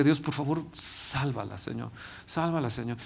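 whisper-quiet plea to God, voice nearly gone; soft, broken prayer for his wife's life; hushed desperate prayer. Retold or imitated. Begging God for his wife.

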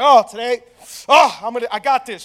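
mock psyching himself up; imitated hype. Imitating his hyped-up friend at the gym.

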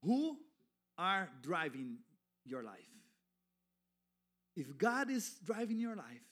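ordinary talk

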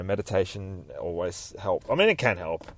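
ordinary delivery

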